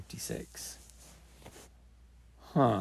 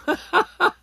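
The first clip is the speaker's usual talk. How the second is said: laughing; 11 dB louder than usual. Real, not acted. Laughs at own date slip.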